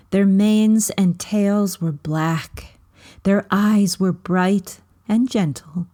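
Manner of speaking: plain reading voice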